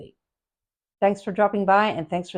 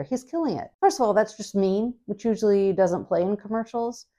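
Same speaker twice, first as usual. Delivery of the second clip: normal talking voice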